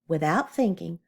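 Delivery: plain reading voice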